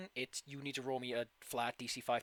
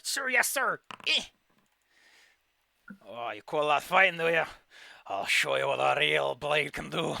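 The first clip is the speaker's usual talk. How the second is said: drill sergeant; 6 dB louder, slower than usual. Acted. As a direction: shout it like a boot-camp recruit. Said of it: "the hobgoblin comes like".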